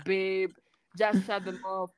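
ordinary talk

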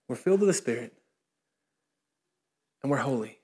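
normal talking voice

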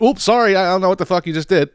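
high, chirpy fake-polite robot; sing-song machine apology, last part fast. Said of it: "the thing went".

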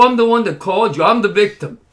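loud, high, rushed insistence; retold indignant protest. Victim who called the police protesting to officer, indignant.

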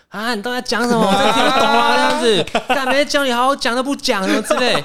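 high, loud sarcastic can't-hear-you taunt; mocking shout. Mocking her boss in front of the office, high and loud. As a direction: hand to ear, taunting him loudly. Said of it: "嘲讽点嘛".

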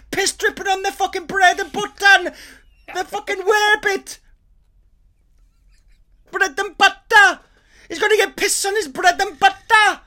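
very high, loud outraged rant drifting Scottish; Welsh ranting into Scottish. Retold or imitated. Snagging guy ranting as the accent slides Scottish. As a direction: lose it completely over bread and butter. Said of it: "It went Scottish".

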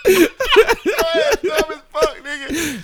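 high cackling laugh through words; laughing hard. Real, not acted. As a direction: laugh it out, barely able to talk. Cracking up at friend.

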